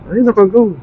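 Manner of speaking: a quick high surprised "ay". Real, not acted. Rider noticing the light already green, surprised. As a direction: caught off guard, pitch jumping up.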